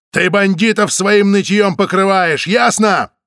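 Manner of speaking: slow, high, loud scolding; angry yell. A narrator's reading. Samoylov scolds the victim, loud angry yell. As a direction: hammer it into her, shouting. Samoylov shaking her, yelling.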